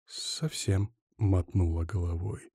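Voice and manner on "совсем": very soft female reply; hushed denial. A narrator's reading. Woman shaking her head at him, hushed. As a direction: barely voice the answer. Quiet denial at close range.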